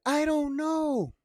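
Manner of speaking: high, slow, drawn-out "I don't know"; a high, dragged-out mock of the lieutenant; mock clueless lieutenant. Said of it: "She's like".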